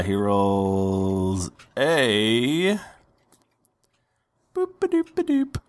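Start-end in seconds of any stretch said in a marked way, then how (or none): 4.5-5.6 high, fast boop-a-doop filler; silly high singsong. Acted. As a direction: chirp it out in a silly squeak. GM fills in roll number.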